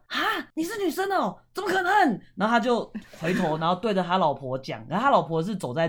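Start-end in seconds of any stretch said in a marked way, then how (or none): 0.1-1.9 high squeaky disbelief, pitched way up; mock astonished uncle. An old uncle, stunned that the guide is a woman, shrieking high in disbelief. Uncle's shocked reply retold.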